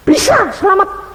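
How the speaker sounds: high, loud declaration; insistent shout. Retold or imitated. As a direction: shout the claim that he can be saved. Pastor insists to the Buddhist.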